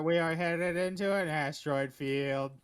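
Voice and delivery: slow, loud robot voice. Acted. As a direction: read it like a robot. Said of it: "I don't wanna do the robot voice".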